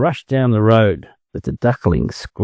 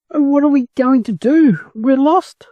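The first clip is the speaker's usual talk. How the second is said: high wailing duckling voices; crying out. Acted. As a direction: cry out, lost and scared. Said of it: "the ducklings cried".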